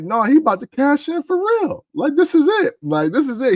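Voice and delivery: very high, urgent retold disbelief; excited fan. Wrestling fan realizing the cash-in is real, high excitement. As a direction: pitch shoots up as the realization hits.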